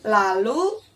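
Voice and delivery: ordinary delivery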